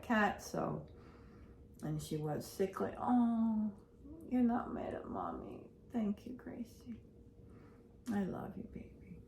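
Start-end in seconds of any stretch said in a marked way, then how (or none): 3.0-9.2 soft whisper to a sick pet; tender whisper. Real, not acted. Owner whispering love to her sick cat Gracie, soft and tender. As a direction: hush it gently to the cat.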